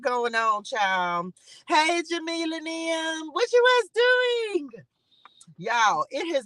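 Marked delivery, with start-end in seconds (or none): 1.7-4.7 high, slow, stretched-out hello to a viewer; sing-song greeting. Real, not acted. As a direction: sing the question up high and draw it out.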